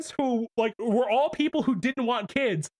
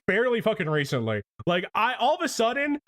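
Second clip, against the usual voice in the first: usual speaking voice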